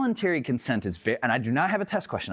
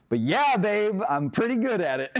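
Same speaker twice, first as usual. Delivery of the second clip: laughing brag; 9 semitones higher than usual. Retold or imitated. Bragging to wife, laughing.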